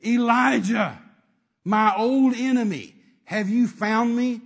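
loud, drawn-out mock dread; hammed-up scared king. King Ahab met by the prophet he fears, hammed-up fearful cry. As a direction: cry out like a cornered king, theatrically. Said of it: "This is the king scared of the prophet".

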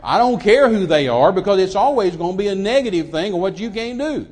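loud, emphatic preacher's refusal; indignant rebuttal. Acted. Preacher answering those who quote what they say, indignant. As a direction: throw the hearsay back forcefully. Preacher rebuffs the gossips he mimicked.

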